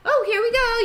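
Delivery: high, brisk, cheerful nurse. Retold or imitated. Nurse distracting the boy, high and cheerful. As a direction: sing it out brightly to distract him. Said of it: "And she's like".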